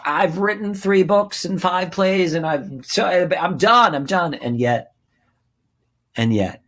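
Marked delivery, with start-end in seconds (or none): 0.0-4.3 higher, louder burst of protest; insistent retold protest. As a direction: insist you are finished with it, voice jumping up. Retells his protest at facing Bob.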